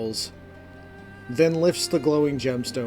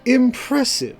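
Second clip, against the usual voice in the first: higher, measured praise; impressed, deliberate verdict, pitched up; Calor, impressed observer; 10 semitones higher, 4 dB louder than usual. A narrator's reading. Calor to the group after the fight, measured praise. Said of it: "each clap deliberate".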